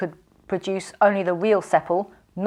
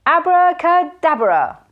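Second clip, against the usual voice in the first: high, loud slow incantation; booming spell; 10 semitones higher, 7 dB louder, slower than usual. A narrator's reading. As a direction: hurl the magic word. Casting a spell.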